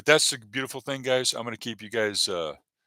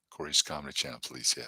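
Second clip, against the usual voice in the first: quiet, lower afterthought; low aside; 7 semitones lower, 5 dB quieter, faster than usual. Pretend. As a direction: repeat Corey's Comedy Channel, police lower and softer, like a muttered aside. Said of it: "in trouble with the C C P".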